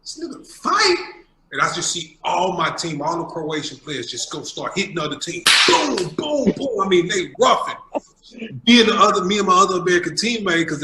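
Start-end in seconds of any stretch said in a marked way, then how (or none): 0.0-1.0 high, loud incredulous question; retold shocked double-take; baffled disbelief. Imitates puzzled reaction to the shout. 5.7-6.8 high, loud punching sound effects; booming blow-by-blow imitation; imitated punches.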